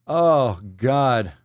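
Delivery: slow, drawn-out exasperation; appalled groan. Real, not acted. Appalled reaction to the post.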